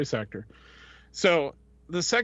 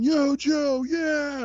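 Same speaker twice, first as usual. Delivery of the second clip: crowd shout; 12 semitones higher, 5 dB louder, much slower than usual. Retold or imitated. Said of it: "there's people going".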